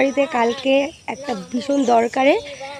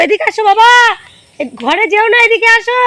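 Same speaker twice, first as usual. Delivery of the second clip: calling out; 9 semitones higher, 6 dB louder than usual. Real, not acted. Calling child over outdoors.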